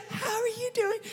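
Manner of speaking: tiny, high, hushed voice; quiet talker. Acted. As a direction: repeat it just as softly, mimicking a quiet talker. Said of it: "they talk like this".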